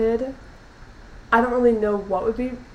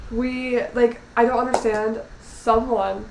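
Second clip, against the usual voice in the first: normal talking voice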